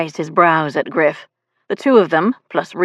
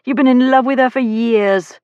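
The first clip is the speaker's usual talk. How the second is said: higher-pitched gleeful teasing; with relish; 6 semitones higher, 4 dB louder than usual. A narrator's reading. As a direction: rub it in with enjoyment. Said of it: "Reese said with relish".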